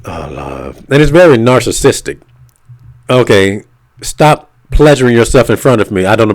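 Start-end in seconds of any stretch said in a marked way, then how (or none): 0.0-0.8 breathy whispered hippie voice; dreamy hippie voice. Retold or imitated. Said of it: "saying it like a hippie from the seventies".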